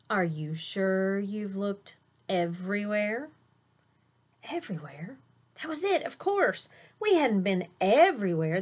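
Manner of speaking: lively storybook read-aloud; reading to children. A narrator's reading. Teacher reading a picture book to her class, animated. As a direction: read it bright and animated for little listeners.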